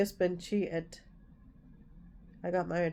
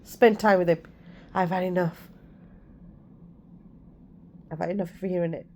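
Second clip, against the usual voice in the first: snapped tone; 4 dB louder, faster than usual. Retold or imitated. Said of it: "That's the tone I used".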